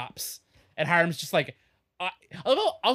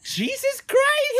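high, drawn-out shocked squeal; shocked exclamation; 4 dB louder, much slower than usual. Retold or imitated. Podcaster recalling her horror at the brawl, high and slow. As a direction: stretch it out in mock horror.

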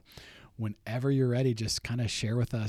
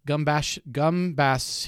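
loud sounding out; 3 semitones higher, 7 dB louder, slower than usual. Real, not acted. Sounding out clue in a game.

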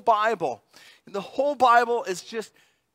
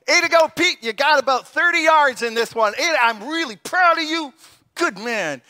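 mock praise; 6 semitones higher, 6 dB louder than usual. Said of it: "what did Jesus say".

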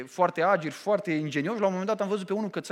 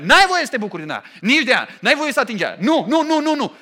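louder, scolding no no no; mock forbidding God; 3 semitones higher, 8 dB louder than usual. Preacher mimics a forbidding God scolding people, loud and stern. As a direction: wag a finger at the whole world, loudly. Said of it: "Și spune".

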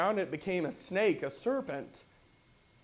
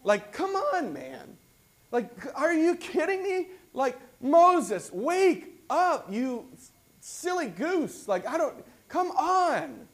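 much higher and loud, playful exasperation at Moses; exasperated; 10 semitones higher, 5 dB louder, slower than usual. Retold or imitated. Reader scolding Moses for his excuses, high and exasperated. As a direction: plead with an old friend who won't listen. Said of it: "and I'm like".

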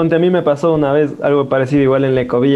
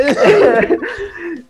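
high burst of laughter; 13 semitones higher than usual. Real, not acted. Laughing at the insult scene, a high burst of laughter. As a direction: crack up, high and bright.

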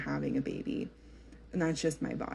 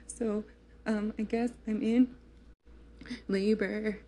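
higher, choked-up telling of the news; tearful phone call; 5 semitones higher than usual. Retold or imitated. Calling her husband, she tearfully says she's in labor. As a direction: speak through tears, voice lifted. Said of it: "like, sobbing".

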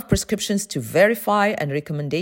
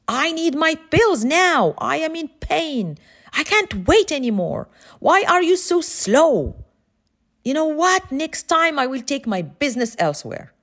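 higher, mock angry customer yelling; retold customer screaming; 7 semitones higher than usual. Pharmacy customer berating the pharmacist in a mock yell. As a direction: imitate an impatient customer demanding pills. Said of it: "One customer started screaming".

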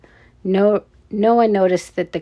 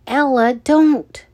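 higher, slow drawn-out plea; urgent warning; 6 semitones higher, 4 dB louder, slower than usual. A narrator's reading. Richie runs after Ella, urgent drawn-out warning. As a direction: stretch the warning out, pleading. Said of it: "Richie warned again".

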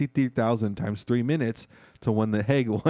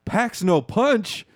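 high, slow, indignant echo; offended disbelief; 11 semitones higher, 3 dB louder, much slower than usual. Retold or imitated. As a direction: drag it out, insulted. Offended reporter voiced in retelling.

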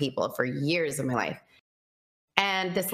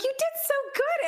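very high, gushing praise; tender praise. Retold or imitated. Telling God he did well, very high and gushing. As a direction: coo it like praising a child.

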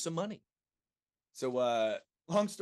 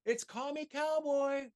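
high, slow imitated yell; slower than usual. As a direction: mimic the partner yelling the title. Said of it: "my partner ends up screaming".

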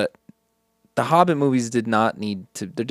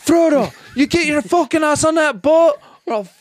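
much higher, loud fake Scottish threat; mock Scottish yell; 6 dB louder than usual. Sam yelling at Frodo to board the boat, in a mock Scottish accent. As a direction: bellow it at Frodo in a hammed-up Scottish brogue. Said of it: "Sounds so, like, Scottish or something".

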